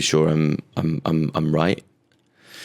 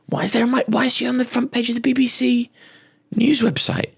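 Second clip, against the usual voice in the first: much higher mock indignant voice; mock outrage. Comic mocking the BBC coverage in a high indignant squeal.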